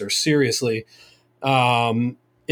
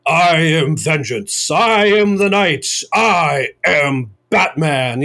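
Kevin Conroy Batman; 2 semitones higher, 4 dB louder, much slower than usual. Retold or imitated. Retelling the actor's Batman line to first responders, slow Batman voice. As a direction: intone it slowly like the Dark Knight. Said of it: "he does it in his full Kevin Conroy".

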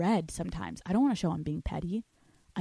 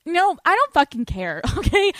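laughing; 9 semitones higher, 6 dB louder than usual. Real, not acted. Laughing defiant dismissal.

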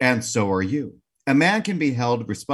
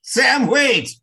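high, loud laughing holler; comic scream; 14 semitones higher, 8 dB louder than usual. Acted. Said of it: "she screamed".

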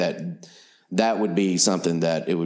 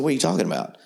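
higher-pitched imitation of a baffled reply; mock puzzlement; 8 semitones higher, faster than usual. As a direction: play his confusion lightly, pitched up. Retold puzzled reply to a question.

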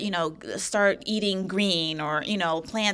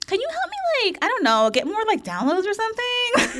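mock plea, much higher and louder, laughing at the end; naive high-pitched plea; 13 semitones higher, 5 dB louder than usual. Recalling asking the coach for downloads, high laughing plea. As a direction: pitch it way up like a clueless newbie. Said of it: "I was like".

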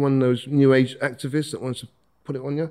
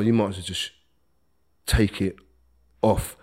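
ordinary delivery

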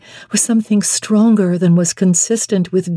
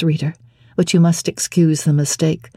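ordinary delivery

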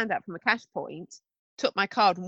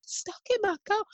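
high, small voice pleading from inside; high-pitched mock plea; mock trapped passenger; 9 semitones higher than usual. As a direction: imitate someone stuck behind a window. Mimics someone trapped in a car.

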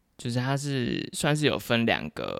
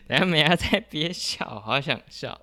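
laughing; 6 semitones higher than usual. Real, not acted.

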